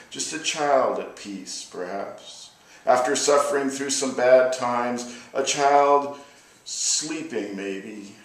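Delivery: measured, weighty poem recital; a poet performing his anti-war poem; poetry reading. Acted. Reads his war poem aloud.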